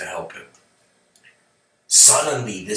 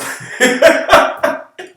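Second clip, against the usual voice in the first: loud laugh; 12 dB louder than usual. Real, not acted. Laughs at punchline.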